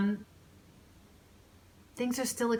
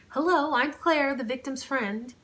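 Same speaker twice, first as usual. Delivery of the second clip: higher, louder friend calling out brightly; called out; 8 semitones higher, 5 dB louder than usual. Acted. Friend Claire arriving at the bedroom, calling out loud. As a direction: announce yourself across the room. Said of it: "she called out".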